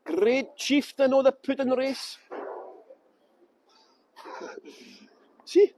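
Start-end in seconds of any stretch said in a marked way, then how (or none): 0.0-2.2 slow, louder poem recital; Burns recitation. Shown as a demonstration. Tour guide showing off his Burns to viewers, slow recitation. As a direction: declaim Burns in broad Scots, drawing it out. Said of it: "I can talk Burns and everything".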